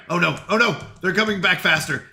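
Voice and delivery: usual speaking voice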